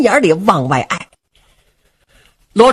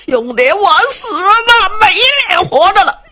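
mock weeping threat; 10 semitones higher than usual. Du Cha threatens suicide with sword.

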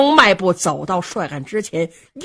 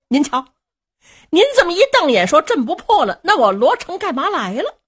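high, quick, playful coaxing; laughing young general; 6 semitones higher, 3 dB louder, faster than usual. Acted. Young marshal soothing his angry cousin, light teasing banter. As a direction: laugh it off and wheedle. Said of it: "跟着扑哧一笑".

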